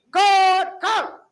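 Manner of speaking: mock the lazy reply; mocking kot. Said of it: "I can't stand this kot".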